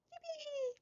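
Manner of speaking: squeaky, very high, very soft cheer; tiny cheer. Pretend. Reader cheering the progress, tiny squeaky yippee. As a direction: squeak it out like a little mascot.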